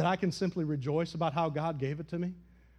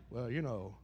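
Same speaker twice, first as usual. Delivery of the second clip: smug believer; 7 semitones lower than usual. Retold or imitated. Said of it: "be like".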